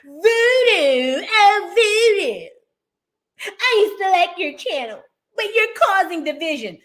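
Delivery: high-pitched mocking whine; mock commenter reading. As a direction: imitate upset commenters in a high, wailing voice. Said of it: "when y'all be saying it".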